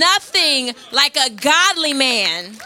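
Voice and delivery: rally the men from the pulpit; preaching at full force. Acted. Pastor to the men of the church, rousing preaching.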